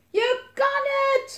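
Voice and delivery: high, drawn-out cheering scream. A narrator's reading. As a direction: scream encouragement from afar. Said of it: "gilde Elise".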